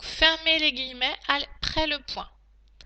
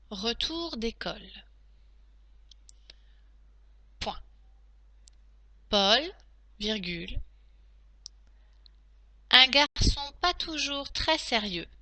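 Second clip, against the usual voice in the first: dictating. Shown as a demonstration. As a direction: dictate each phrase clearly to pupils. Teacher dictating to class.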